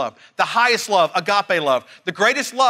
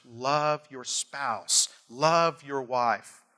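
slow soft exhortation; 4 semitones lower, 5 dB quieter, much slower than usual. Acted.

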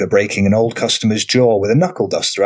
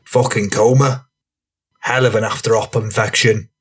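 rough lad voice. A narrator's reading. Franny character voice.

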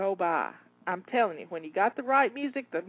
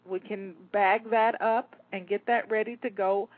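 usual speaking voice

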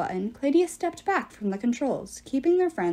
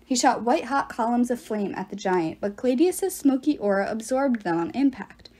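ordinary talk, nothing marked

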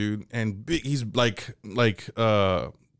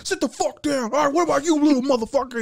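inmate bully; 13 semitones higher, faster than usual. Retold or imitated. Inmate interrogating kids.

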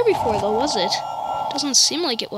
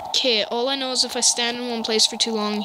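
normal talking voice

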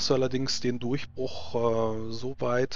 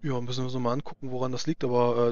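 normal talking voice

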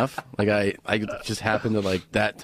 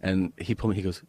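usual speaking voice

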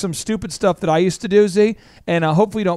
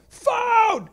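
high-pitched drawn-out holler; retold yell. Boss hollering for someone to answer the ringing phone, high yell. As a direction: call it out high, more pitch than volume. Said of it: "I would yell".

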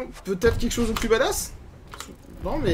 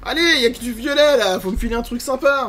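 loud, higher plea to the game; louder, insistent begging; pleading shout; 4 semitones higher, 5 dB louder than usual. Real, not acted. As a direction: urge the pack on, raised voice. Begging the game for good drop.

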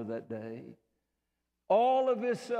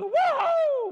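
very high, loud whoop of joy; joyful whoop; 11 dB louder than usual. Pretend. Preacher voicing Jesus rejoicing over the saved, loud high whoop. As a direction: let out a celebrating holler. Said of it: "I don't know if Jesus is gonna holler".

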